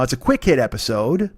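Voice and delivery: ordinary delivery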